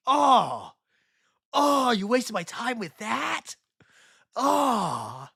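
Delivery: loud, high, drawn-out dismay; exasperated groaning. Real, not acted. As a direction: wail at the wasted time. Groans at the wasted time.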